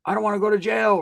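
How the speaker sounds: retold childish plea; scared kid. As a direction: imitate a frightened little boy begging. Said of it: "I'm scared to death".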